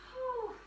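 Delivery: high, soft whew. Real, not acted. Instructor winding down between breaths, soft whew. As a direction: let out a light high whew.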